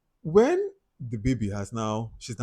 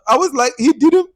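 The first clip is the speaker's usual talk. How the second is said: shocked; 10 dB louder than usual. Real, not acted. Outraged at the father's silence.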